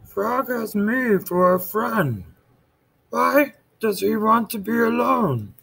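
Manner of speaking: high, slow puzzled Toad; Toad's voice. Acted. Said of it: "said Toad".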